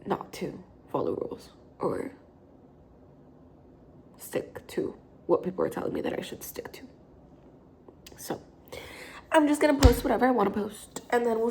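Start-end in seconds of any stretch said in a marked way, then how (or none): 0.1-8.4 hushed, breathy aside; whispering. Real, not acted. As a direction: say it almost under your breath. Reflective aside about breaking rules.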